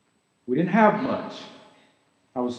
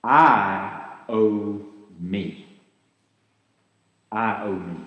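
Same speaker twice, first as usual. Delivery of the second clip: slow, lower, self-satisfied murmur; drawn-out lower voice of greed; 6 semitones lower, slower than usual. Retold or imitated. Pastor voicing greed for the congregation, slow and lower. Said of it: "What do you imagine that voice says".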